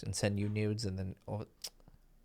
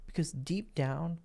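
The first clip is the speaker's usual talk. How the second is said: ordinary talk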